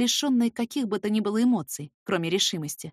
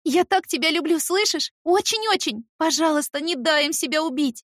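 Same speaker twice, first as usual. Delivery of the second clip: high loud pleading through tears; tearful pleading; 9 semitones higher, 6 dB louder than usual. Acted. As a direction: beg him, on the edge of sobbing. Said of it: "и просто реву".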